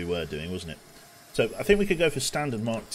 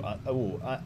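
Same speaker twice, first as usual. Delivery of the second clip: ordinary talk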